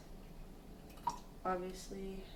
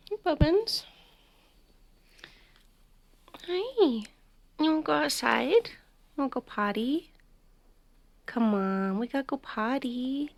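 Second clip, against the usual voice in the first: higher, coaxing dog-owner voice; pitched-up pet talk; 5 semitones higher than usual. Real, not acted. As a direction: coax the dog along in a lifted, sing-song pitch. Calling and coaxing her dog.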